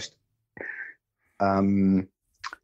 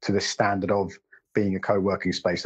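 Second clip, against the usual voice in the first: ordinary talk, nothing marked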